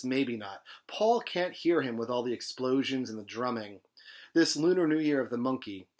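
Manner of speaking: lively reading of a novel; story reading. A narrator's reading. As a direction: read the novel aloud with energy. Reads novel aloud.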